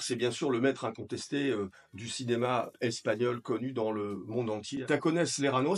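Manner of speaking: usual speaking voice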